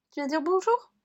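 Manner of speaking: talking to the pet, high and sweet. Real, not acted. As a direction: coax the pet to come say hello. Talks to her pet Roquette.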